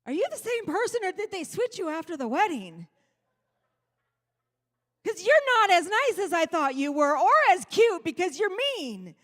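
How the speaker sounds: high, loud, indignant accusation; hammed-up betrayed newlywed; mock accusing wife. Mock accusation of her husband.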